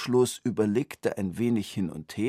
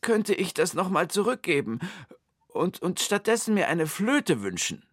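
much higher, timid pleading request; pleading man; 10 semitones higher, 4 dB louder than usual. A narrator's reading. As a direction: ask the genie meekly for a swap. Wunschlos asks genie. (schüchtern bittend, deutlich höher)

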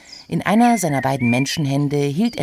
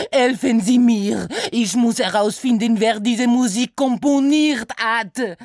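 höher, lauter, mit französischem Akzent klagend; verzweifelter Franzose; 9 semitones higher, 4 dB louder than usual. Acted. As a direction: gehetzt um Hilfe flehen, französischer Akzent. Saint-Saëns voice. (verzweifelt, französischer Akzent)

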